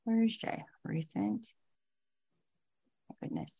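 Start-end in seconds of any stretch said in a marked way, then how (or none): 3.1-3.5 soft unvoiced whisper to herself; real exasperated whisper; whispered aside. Real, not acted. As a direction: breathe it out under your breath. Aside during tech trouble.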